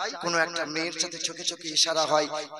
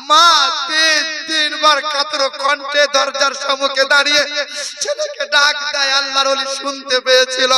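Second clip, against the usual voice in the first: high, loud preacher's chant; preaching at full force; 8 semitones higher, 8 dB louder than usual. Acted. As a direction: drive the story home to the crowd at full voice.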